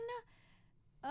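a child's despairing mock-sob; mock-sobbing little boy; imitating a bawling kid. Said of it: "he was bawling".

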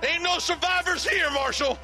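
deputy calling the marshal over. Acted. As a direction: shout across the ruins. Deputy calling across burned ranch.

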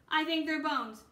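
alarmed discovery. Acted. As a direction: blurt it out as the bones register. Annie finds bones in cave.

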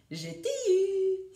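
high, loud, stretched gotcha; playful storybook pouncing shout; crying out. A narrator's reading. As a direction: draw out a high cry of triumph. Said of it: "s'écria-t-il".

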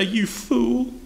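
high, scornful crowd jeer; mocking crowd. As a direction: sneer it like the scoffers. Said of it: "they say".

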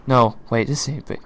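ordinary talk

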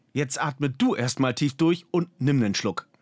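usual speaking voice

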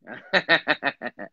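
real chuckle in short bursts; chuckling laugh. Real, not acted. Speaker amused at who dares curse police, chuckling. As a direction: laugh in a quick string of chuckles.

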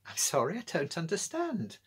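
a lightly voiced muddled old woman; confused old lady. A narrator's reading.

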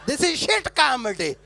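auntie voice. Retold or imitated. Voicing the disapproving auntie.

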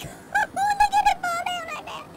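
very high, drawn-out mock squeal; imitated high-pitched laughing crowd; imitating amused players. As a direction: squeal it as a giggling onlooker. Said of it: "everybody would, like".

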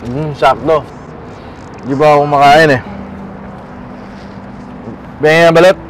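put-on slurring drunk customer, low and heavy. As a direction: talk like a blackout drunk. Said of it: "Mukhang lasing na lasing kayo".

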